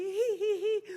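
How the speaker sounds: high mimicked tittering; mock a girl's high giggle; imitated girlish giggle. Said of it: "I hear another giggle".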